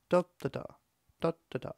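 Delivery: lilting "da" groups, first beat heavy; swinging beats. Shown as a demonstration. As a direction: tap DA-da-da, long-short-short. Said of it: "There is a difference there".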